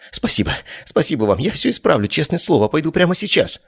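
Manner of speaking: high, breathless rushed thanks; frantic high gratitude. A narrator's reading. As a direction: gush it out in a panic, high. Teo thanks in panic.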